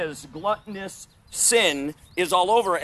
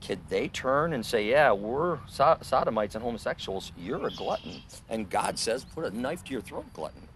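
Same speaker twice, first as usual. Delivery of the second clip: quiet private word; 4 semitones lower, 8 dB quieter than usual. Retold or imitated. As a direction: say it low so only he hears. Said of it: "I didn't yell it out".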